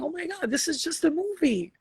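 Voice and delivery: high, loud mock-crying wail; a guide hamming up tears; mock weepy spirit guide. Spirit guides crying over the human movie, high mock wail. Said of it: "But then they're forgetting".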